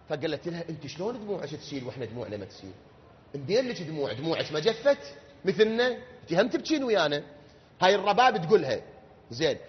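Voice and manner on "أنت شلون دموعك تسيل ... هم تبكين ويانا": low, soft, quick plaint to a servant; a widow asking in quiet wonder; grieving lady's voice. Acted. Rubab asking her weeping servant, soft lament.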